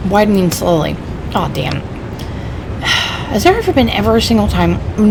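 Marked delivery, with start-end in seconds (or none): 1.8-3.3 long exasperated sigh; weary sigh. Acted. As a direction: breathe out in exasperation. Exasperated companion.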